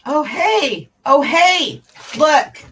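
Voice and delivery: high, loud excited calling. Real, not acted. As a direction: call out with delight. Excitedly pointing something out.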